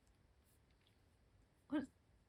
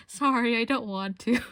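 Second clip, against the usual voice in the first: louder sheepish apology; awkward refusal; 7 dB louder than usual. Retold or imitated. Turning down strangers wanting to trade, sheepishly louder. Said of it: "I was like".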